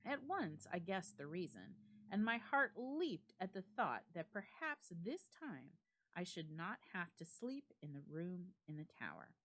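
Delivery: ordinary delivery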